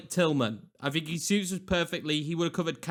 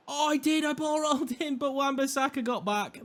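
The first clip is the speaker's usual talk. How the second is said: excited; 9 semitones higher than usual. Real, not acted. Thinks he has beaten defender.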